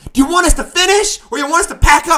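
fast, high, loud yell to a crowd; rallying shout. Acted. Singer asking the crowd to back him, rallying shout. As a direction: rile up the audience with it.

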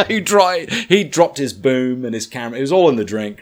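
laughing while telling it; breaking into laughter mid-story. Real, not acted.

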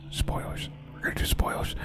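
hushed, unvoiced spoiler warning; whispered aside. Real, not acted. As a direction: whisper the warning under your breath.

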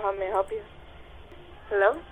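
normal talking voice

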